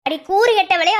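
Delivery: loud, sharp rebuke; scolding shout. Acted. Relative scolding Latha for the meeting, loud rebuke. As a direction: bark it at her.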